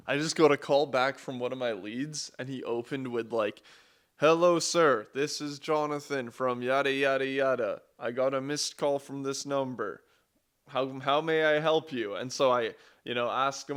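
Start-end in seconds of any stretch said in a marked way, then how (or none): 4.1-12.1 a light imitation of a chirpy phone greeting, a bit louder; mock receptionist greeting. Retelling a caller's greeting, lightly imitated, polite and loud. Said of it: "In the exact same tone".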